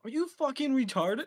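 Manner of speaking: high, loud yell of disbelief; incredulous yell. Real, not acted. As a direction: yell it like you can't believe them. Outburst at friend in game.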